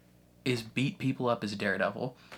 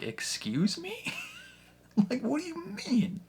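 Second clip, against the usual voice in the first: incredulous excuse me. Retold or imitated. Matt to his girlfriend, offended and incredulous. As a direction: pitch it up in disbelief.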